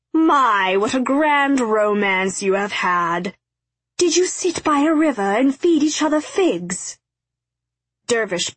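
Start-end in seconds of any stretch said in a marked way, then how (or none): 0.1-7.0 theatrical taunt with high swoops; gleeful mockery. A narrator's reading. Dervish ridicules X's romance before the lords, gleeful mockery. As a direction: tease grandly, letting the pitch leap. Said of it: "Dervish hooted with glee".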